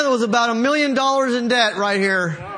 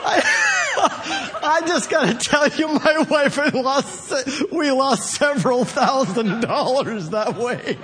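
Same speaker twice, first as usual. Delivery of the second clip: laughing hard through the words; can barely speak for laughter; 3 semitones higher than usual. Real, not acted. Preacher telling his congregation about lost money, laughing hard. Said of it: "Oh my God".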